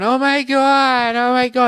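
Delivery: high, slow, hammed-up "oh my God" wail; exaggerated whiny girl gasping oh my God; hysterical girl. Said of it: "Jersey Shore girl's going".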